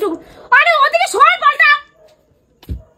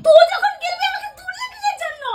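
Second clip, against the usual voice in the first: whiny angry protest; 7 semitones higher than usual. Real, not acted. Woken up, complaining angrily.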